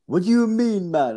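high, loud mock Jamaican dealer voice; a light Jamaican lilt, pitched well up; Jamaican accent. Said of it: "It's, like, a Jamaican dude".

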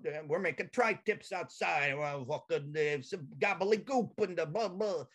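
mock the tough-guy father's bluster; dad impression. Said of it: "he liked to, you know".

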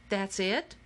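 underwhelmed question, rising at the end; let-down question. A narrator's reading. Player after the basket just rolls away, let-down rising question. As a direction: ask it in mild disbelief, voice lifting on the last word. Letdown after nothing else happened.

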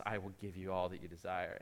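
soft, low tempter, lightly voiced; hushed voice of the ice cream; tempting ice cream voice. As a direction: coax him like a sly temptation.

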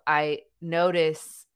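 ordinary talk, nothing marked

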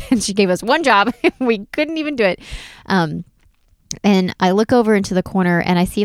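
normal talking voice

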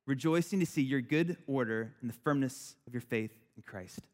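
low, measured Bible reading; scripture reading. Acted. Reads scripture before sermon.

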